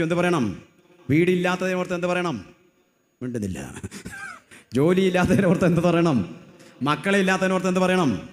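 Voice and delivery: loud, high, rising preacher's cry, question after question; preaching at full force. Acted. Preacher to the congregation, booming rhetorical questions. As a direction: hammer each question at the crowd.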